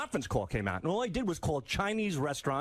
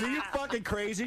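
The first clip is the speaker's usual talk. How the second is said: higher-pitched indignant quote; retold incredulity; 6 semitones higher than usual. Man challenging the woman on the phone, mock incredulous. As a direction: imitate an exasperated man's disbelief.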